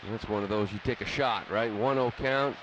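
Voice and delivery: ordinary talk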